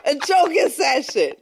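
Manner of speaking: loud, high laughing shout; laughing disbelief. Real, not acted.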